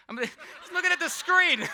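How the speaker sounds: words bursting through real laughter; can barely get it out for laughing. Real, not acted. Host to audience member, cracking up mid-line.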